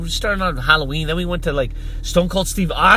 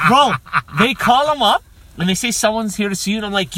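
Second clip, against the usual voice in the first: ordinary talk, nothing marked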